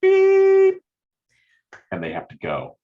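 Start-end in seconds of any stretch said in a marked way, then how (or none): 0.0-0.8 high, loud shrill beep like a phone; sudden loud voicemail beep. Shown as a demonstration. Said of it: "Leave your thirty-second breakup voicemail".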